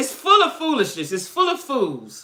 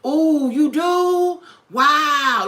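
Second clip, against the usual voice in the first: mock impressed; 6 semitones higher, 4 dB louder, much slower than usual. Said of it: "be like".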